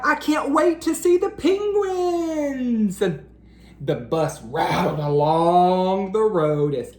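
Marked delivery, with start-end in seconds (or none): 0.0-3.0 high, eager voice; can barely hold it in; excited high voice. Acted. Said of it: "cried Splat".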